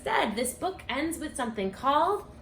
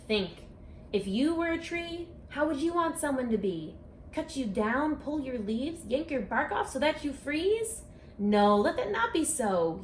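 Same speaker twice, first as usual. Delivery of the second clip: lively sing-song picture-book read-aloud; reading to children. Acted. Author reading her rhyming book to children, storytime voice. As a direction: read it like storytime for a class of kids.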